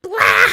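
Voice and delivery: a high, loud squeaked blah; squeaky blah. Shown as a demonstration. Testing the Cream voice, loud high squeaky blah. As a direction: blurt out a high cartoon blah.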